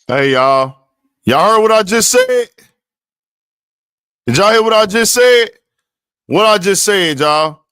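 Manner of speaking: loud, insistent call to the chat. Real, not acted. Host on live stream calling out to his viewers, loud. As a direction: demand the viewers answer you, loudly.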